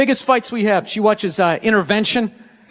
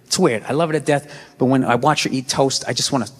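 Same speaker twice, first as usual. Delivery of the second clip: much lower, hushed man-to-man confession; confiding boyfriend; 10 semitones lower, faster than usual. Retold or imitated. Telling her son about his mother: low, hushed confession. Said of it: "the maddest she ever got".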